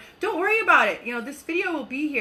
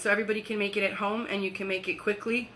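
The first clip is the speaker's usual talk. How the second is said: rushing; 3 semitones lower, 5 dB quieter, faster than usual. Real, not acted. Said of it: "I speak really quickly when I get excited".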